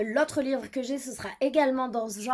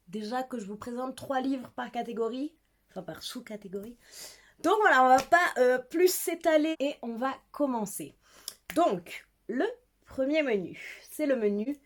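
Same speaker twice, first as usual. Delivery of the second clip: ordinary talk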